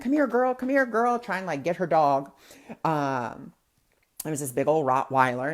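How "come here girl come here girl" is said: high sing-song coaxing call; coaxing a dog. Retold or imitated. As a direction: coax the dog back lightly. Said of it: "she's like".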